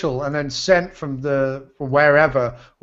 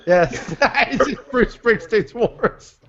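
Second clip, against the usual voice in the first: slow words broken by laughter; high, drawn-out giggling through the words; laughing; 6 semitones higher, much slower than usual. Real, not acted. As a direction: crack up while repeating the joke. Cracking up, echoing the joke.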